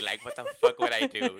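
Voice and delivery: high, laughing panicked self-quote; squeaky laughing disbelief. Retold or imitated. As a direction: crack up while voicing your past self. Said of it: "I'm like".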